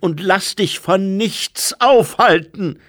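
loud, high urgent shout. A narrator's reading. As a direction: yell at the boy to run. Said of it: "rief Lucé". (Lucé ruft laut und drängend)

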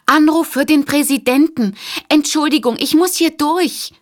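a flustered operator calling to get through the crowd. Acted.

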